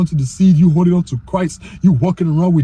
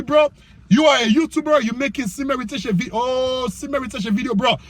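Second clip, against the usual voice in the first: usual speaking voice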